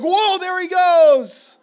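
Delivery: put-on high, very loud, drawn-out kid's yell; excited scream. [name] watching his fish take off, excited scream. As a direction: howl it out like an amazed boy.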